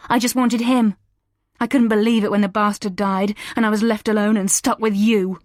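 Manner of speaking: high, loud raging outburst. A narrator's reading. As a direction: let the rage drive the words out. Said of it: "Rage drove the words out".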